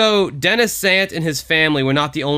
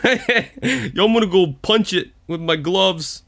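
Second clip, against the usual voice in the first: higher New York bruiser with a laugh; a light Rocky voice boasting; Rocky impression; 4 semitones higher, slower than usual. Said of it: "sounds like Rocky".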